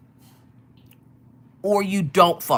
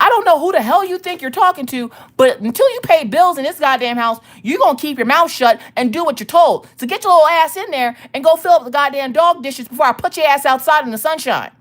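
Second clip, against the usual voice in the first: loud, high-pitched angry rant; acted-out furious mom tirade; yelling; 7 semitones higher, 5 dB louder than usual. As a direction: lay down the law at full volume. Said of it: "I'm going to yell at you".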